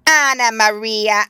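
slow, loud squeaky call of a rat; an old rat calling out for his wife; twitchy squeaking rat voice. Acted. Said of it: "squeaked the rat".

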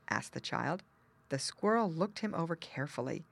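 usual speaking voice

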